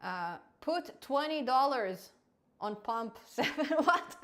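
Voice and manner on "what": laughing what. Real, not acted. Bot's joke reply surprises him.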